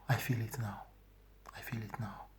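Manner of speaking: a soft whisper, fading out. Real, not acted. Reliving the shame of the dream, in a whisper. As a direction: breathe the words, almost gone. Repeats the feeling.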